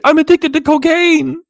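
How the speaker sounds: high, loud mocking squeal of a fake confession. Streamer mocking Chantel's confession to the camera, high mock squeal. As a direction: ham up her dramatic confession. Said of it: "looked in the camera and said".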